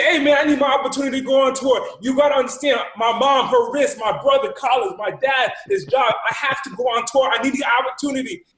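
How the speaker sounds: high, loud, urgent plea; desperate pleading. Retold or imitated. Student begs for tour spot on group call, desperate pleading. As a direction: plead at full pitch, piling up the reasons.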